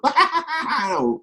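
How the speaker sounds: big, high-pitched acted belly laugh; laughing fit. Shown as a demonstration. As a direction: burst into an over-the-top fit of laughter. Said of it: "there I go into my fit".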